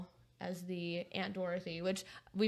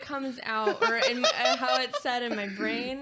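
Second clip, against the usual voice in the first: laughing; 4 semitones higher, 6 dB louder, slower than usual. Real, not acted. Laughs defending herself.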